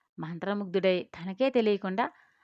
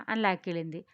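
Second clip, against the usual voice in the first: ordinary delivery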